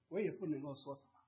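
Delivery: ordinary talk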